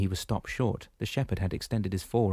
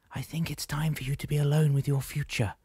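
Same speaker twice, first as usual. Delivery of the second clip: put-on higher, set-apart voice of a wise old woman; gentle oracle voice, pitched up; Solangi's voice; 7 semitones higher than usual. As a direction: speak as the calm old guide Solangi. Solangi speaks, voiced figure.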